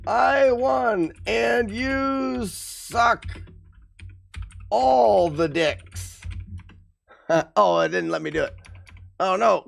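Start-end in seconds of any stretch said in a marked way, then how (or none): 0.1-1.1 gloating. Real, not acted. Gloating over rival at top. 1.3-6.2 slow drawn-out taunt. Acted. Taunting the rival slowly.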